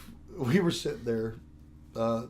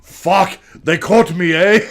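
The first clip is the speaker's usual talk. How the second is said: loud mock Canadian; 4 semitones higher, 12 dB louder, slower than usual. Acting caught Canadian robber.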